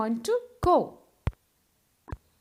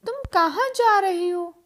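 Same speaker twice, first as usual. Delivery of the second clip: ordinary talk, nothing marked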